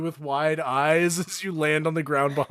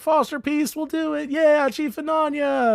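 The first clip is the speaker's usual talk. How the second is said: cheering crowd; 11 semitones higher than usual. Acted. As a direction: cheer your new chief on. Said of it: "They all start cheering".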